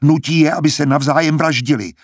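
outraged cry. A narrator's reading. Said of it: "vykřikl Sejanus".

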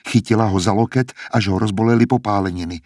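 usual speaking voice

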